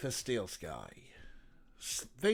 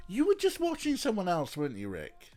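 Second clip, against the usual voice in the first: high, loud teasing accusation; playful gotcha to a viewer; mock accusing squeal; 9 semitones higher, 6 dB louder than usual. Real, not acted. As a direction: catch him out with a squeal. Teasing a chatter named Rick.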